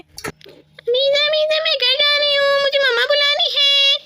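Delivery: put-on high, small child voice; a girl saying she must go home; high childish voice, hurried; little friend leaving. Friend Samiya's voice.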